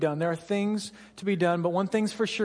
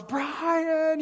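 mock yell; 10 semitones higher than usual. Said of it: "finally she yells".

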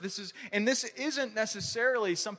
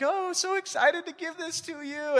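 much higher, gushing mom impression; mom's voice; 10 semitones higher than usual. Pastor imitating his mom giving the chocolate bunny. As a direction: play the doting mother handing over a gift. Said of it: "she's like".

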